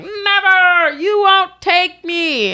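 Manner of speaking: high, drawn-out cry of refusal; mock defiant yell. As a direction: imitate a man yelling as he refuses to go. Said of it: "he just kept yelling".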